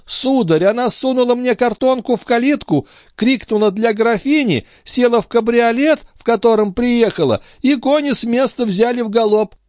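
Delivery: high-pitched porter's voice; anxious servant reporting, voice held high; flustered servant. A narrator's reading. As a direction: gabble out an excuse to the master. Porter explains himself under questioning.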